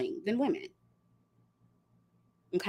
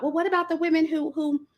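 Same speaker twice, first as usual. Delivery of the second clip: higher, quick challenging question; mock objection; 8 semitones higher than usual. Voicing the argument that comes up a lot, mock challenging. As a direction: imitate an objector butting in, pitched up and hurried.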